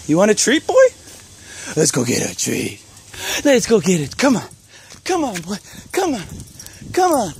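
very high sing-song dog talk. Real, not acted. Coaxing dog named Banjo.

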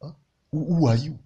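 normal talking voice

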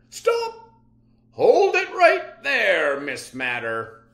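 loud, high commanding shout, slowed on hold it; police shout. Acted. Captain stopping the villain at the bank, loud and high shout. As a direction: bark the order to freeze like a captain.

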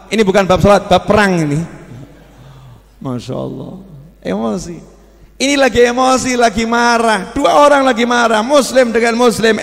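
ordinary talk

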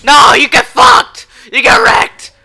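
screaming trash talk; gloating shout. Real, not acted. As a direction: yell it at the enemy. Shouting at enemy.

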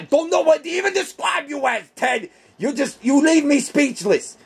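high, exasperated outburst; exasperated shout. Acted. Teddy bear shouting at Ted in exasperation.

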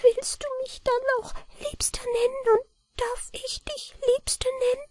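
a tiny man shyly hoping to be loved; high faint gnome voice; weak mannikin voice. A narrator's reading. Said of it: "Mit schwacher Stimme fragte".

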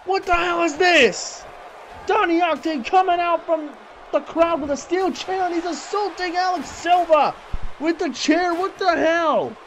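shocked shouting. Real, not acted. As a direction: yell in disbelief at the attack.

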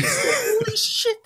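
high, drawn-out laughing exclamation; stunned, laughing it out slowly; laughing shock. Real, not acted. Shocked by news of broken arm.

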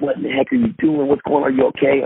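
high-pitched, urgent questioning; worked-up mock panic; retold alarm. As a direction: re-enact rushing up to a crashed friend, pitch jumping up. Retelling his alarm at friend's crash.

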